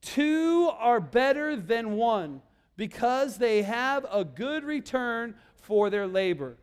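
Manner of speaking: leading the memory verse very slowly; slow, word by word for the children to repeat; slow recitation. Shown as a demonstration. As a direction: say each word slowly so they can follow. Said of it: "All right, say it again".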